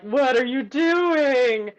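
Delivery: loud, drawn-out incredulous question; hammed-up bewildered housemate; baffled onlooker. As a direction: stretch it out in mock disbelief. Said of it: "people who are sharing their workspaces are like".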